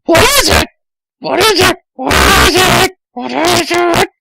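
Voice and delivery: very high, loud eager questions; eager high Amy. Acted. Amy asking Tails what he needs, high eager voice. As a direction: pester in a squeaky girl voice. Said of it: "I asked".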